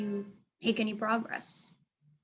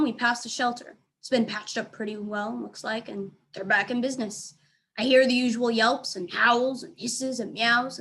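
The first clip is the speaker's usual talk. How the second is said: ordinary delivery, nothing marked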